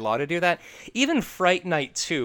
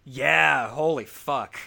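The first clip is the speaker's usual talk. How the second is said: usual speaking voice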